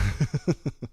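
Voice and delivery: wordless chuckle in quick bursts; laugh. Real, not acted. Streamer after mocking the governor, laughing.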